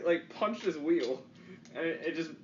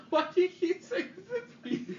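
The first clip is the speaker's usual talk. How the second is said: very high, slow voice breaking with laughter; silly falsetto question, laughing; squeaky mock imitation. Teasing friend about the Zippy story, squeaky mock voice.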